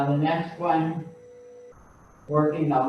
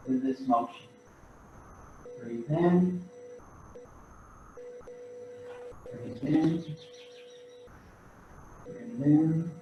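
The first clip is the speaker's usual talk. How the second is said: gently pace the class's breaths, quieter; guided breathing cues; 2 semitones higher, 7 dB quieter than usual. Acted. Instructor pacing the class's breaths, soft and calm.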